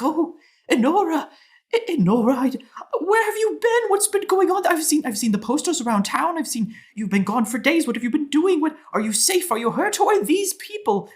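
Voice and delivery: high-pitched, fretting torrent of questions; frantic mother. Acted. Dwarf mother fretting over her returned daughter, frantic. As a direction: fuss frantically, tripping over your own words. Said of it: "sounding frantic".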